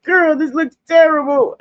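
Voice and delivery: high, loud cry of dismay at her makeup; dismayed complaint. Retold or imitated. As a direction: whine that it looks awful. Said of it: "I used to be like".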